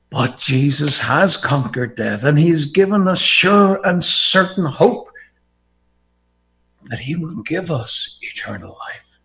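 ordinary talk, nothing marked

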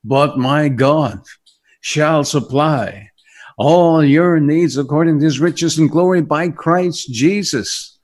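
slow, loud preaching of the verse; scripture recital. Acted. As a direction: proclaim the verse slowly.

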